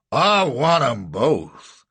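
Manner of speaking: slow, loud rough demand; blunt bounty man; slave catcher demand. A narrator's reading. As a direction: drag it out stubbornly.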